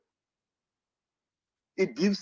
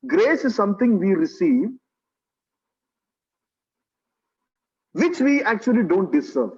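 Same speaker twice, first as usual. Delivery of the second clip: loud, raised sermon voice; forceful preaching; 4 semitones higher, 6 dB louder than usual. Real, not acted. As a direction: drive the teaching home to the congregation. Preaches on grace and mercy.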